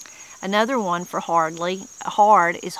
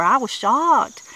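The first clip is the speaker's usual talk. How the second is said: mock shock; 5 semitones higher, 5 dB louder, slower than usual. Acting out shock example.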